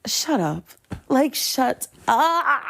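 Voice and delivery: exasperated shut up. Retold or imitated. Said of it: "I'm just in there like".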